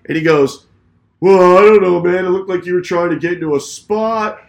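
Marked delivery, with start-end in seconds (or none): 1.2-4.4 loud, higher-pitched defensive excuse; mocked other driver. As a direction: imitate a driver protesting his innocence. Other driver's excuse, mockingly acted.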